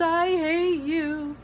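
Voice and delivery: put-on high, slow, drawn-out boy's howl; wailing child's howl. A narrator's reading. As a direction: howl it through tears. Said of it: "he howled".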